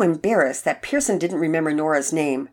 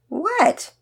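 put-on high, puzzled little boy; confused boy; 12 semitones higher, 4 dB louder than usual. A narrator's reading. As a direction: ask it like a clueless kid.

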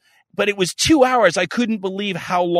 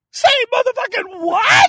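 high hammed-up yell of disbelief; mock outraged shout; 5 dB louder than usual. Mocking the therapist's gold star offer, in a high hammed-up shout. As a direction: blast it out in fake outrage.

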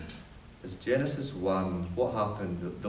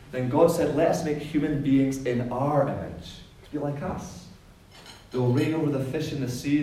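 booming biblical narration; 3 semitones higher than usual. Acted. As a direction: proclaim each line like scripture. Reading God's words aloud.

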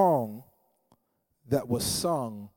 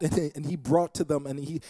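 usual speaking voice

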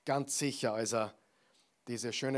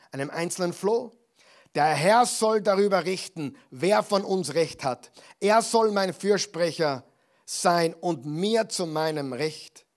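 loud, higher-pitched dramatic Bible reading; impassioned declamation; 3 semitones higher, 6 dB louder than usual. Acted. David appeals to the king who hunts him, impassioned declamation. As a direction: proclaim it forcefully, as an accused man appealing to God. David confronts Saul, read aloud.

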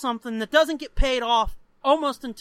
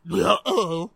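cartoon dog catchphrase, louder; Scooby; 3 semitones lower, 5 dB louder than usual. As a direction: put on a comic talking-dog voice. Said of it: "And Scooby says".

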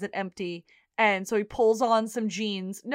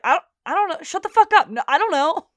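flustered denial; 8 semitones higher, 8 dB louder, faster than usual. Retold or imitated. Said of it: "he's like".

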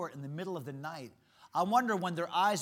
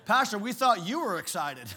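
congregant; 4 semitones higher, 9 dB louder than usual. Retold or imitated. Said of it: "our people are like".

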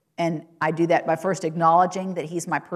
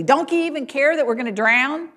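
high, louder frantic cry; 8 semitones higher, 6 dB louder than usual. Acted. Disciples crying out to Jesus in the storm, frantic. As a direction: panic in the storm. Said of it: "asked Jesus frantically".

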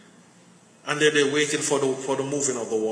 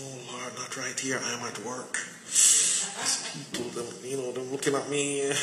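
much softer, hushed protest, as if coworkers might overhear; embarrassed hush; 7 dB quieter than usual. Pretend. Protesting God's timing at work.

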